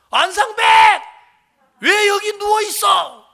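high, loud shout at someone lying down; shouting in full voice. Retold or imitated. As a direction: holler it out like a scolding. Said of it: "막 완전히 막 고함을 치면서".

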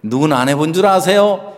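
ordinary talk

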